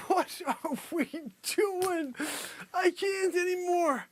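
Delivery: high, slow, weepy from laughing; nearly crying. Real, not acted. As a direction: whimper it, worn out by laughter. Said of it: "You're making me cry".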